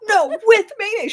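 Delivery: exasperated customer. Retold or imitated. Customer freaking out.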